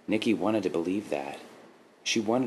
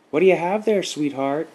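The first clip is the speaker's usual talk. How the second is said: high, raised motherly call; 9 semitones higher, 6 dB louder than usual. A narrator's reading. Mother calls to her daughter from her chair, calling out. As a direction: call over to your child from a chair. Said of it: "her mother called from her chair".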